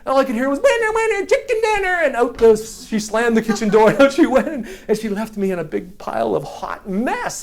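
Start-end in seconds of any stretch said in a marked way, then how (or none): 0.6-2.0 high, singsong mock girl voice; taunting sister. Sister gloating over winning the race, high taunting voice. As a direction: crow it as a gloating kid sister. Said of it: "then I heard her voice".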